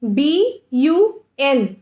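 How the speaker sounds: B U N sounded out letter by letter, very slowly; teacher sounding out the letters of a word. Shown as a demonstration. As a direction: drag out each sound. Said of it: "What is this?".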